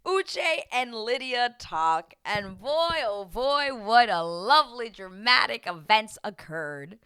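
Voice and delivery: loud, slow, drawn-out sarcasm; mock-grand announcement. Recapper introducing the dramatic talk, loud ironic relish. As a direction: savor each word loudly like juicy drama.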